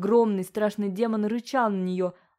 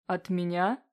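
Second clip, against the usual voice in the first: rumbling demon; slower than usual. A narrator's reading. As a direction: rumble the question lightly. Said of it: "пророкотал демон".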